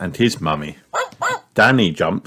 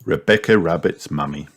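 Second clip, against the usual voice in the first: usual speaking voice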